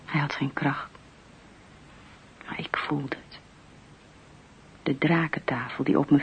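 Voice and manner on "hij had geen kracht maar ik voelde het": soft, hushed telling; almost a breath; whispered narration. A narrator's reading.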